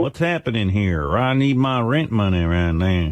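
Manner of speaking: slow Western landlord drawl; Western accent. Pretend. Landlord demanding rent money, Western accent. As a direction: stretch the words out in a Western accent. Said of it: "The landlord should do that accent".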